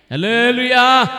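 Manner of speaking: loud, high hallelujah call; preacher's rallying cry; praise shout. Real, not acted. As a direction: ring it out to rouse the congregation. Preacher calls congregation to praise.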